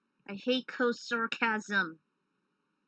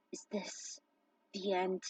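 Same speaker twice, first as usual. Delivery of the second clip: whispered, defeated; Sion, trapped under the walls, whispering in despair; hopeless whisper; 3 semitones lower, 7 dB quieter than usual. Acted. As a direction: barely breathe the words out.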